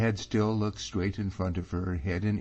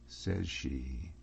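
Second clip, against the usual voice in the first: plain reading voice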